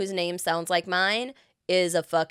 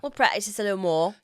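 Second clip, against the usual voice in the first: put-on British accent; playful attempt at a British accent. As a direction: say it in amateur British. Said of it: "you know, British accent".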